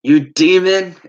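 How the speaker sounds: loud, high mimicked accusation; mock furious outburst; retold angry accusation. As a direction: hurl it like an enraged preacher, imitating. Retold leader before grabbing him.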